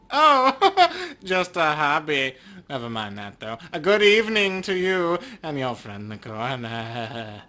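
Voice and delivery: odd-timbred elf, giggling through the words; sly, creepy undertaker elf brushing off the question. Acted. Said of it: "a strange, high-pitched warble".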